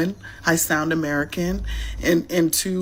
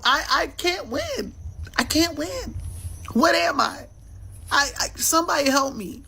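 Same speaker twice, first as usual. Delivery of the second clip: mock despair; 6 semitones higher than usual. Mock despair over identity.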